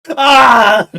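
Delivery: a real loud high anguished scream. Real, not acted. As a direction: let the pain burst out in one cry. Overwhelmed reaction to scene.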